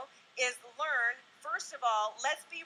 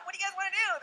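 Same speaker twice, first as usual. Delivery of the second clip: chirpy morning cheer; 7 semitones higher, faster than usual. Retold or imitated. Bouncing into daughters' room at six.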